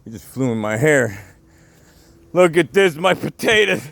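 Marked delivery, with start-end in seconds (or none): 2.3-3.8 higher, louder exclamation; dismayed outcry. Real, not acted. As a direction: cry out at the ruined plants. Finds storm-flattened potatoes.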